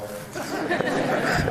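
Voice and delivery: a sudden high burst of laughter; real laugh. Real, not acted. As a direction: laugh it out, half embarrassed. Overcome laughing mid-speech.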